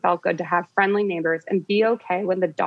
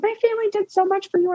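high, fast tantrum whine; 13 semitones higher, faster than usual. Retold or imitated. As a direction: imitate the daughter's tantrum. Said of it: "She's like".

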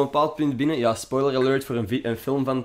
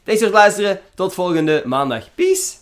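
outro sign-off; 6 semitones higher, 6 dB louder than usual. Real, not acted.

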